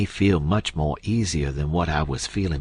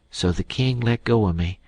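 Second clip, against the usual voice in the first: usual speaking voice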